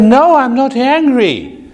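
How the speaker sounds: high-pitched protest, imitating himself talking loud; loud emphatic denial. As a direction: deny it emphatically, pitch jumping right up. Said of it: "when I also talk loud".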